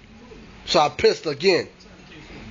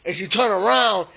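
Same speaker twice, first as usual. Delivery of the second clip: usual speaking voice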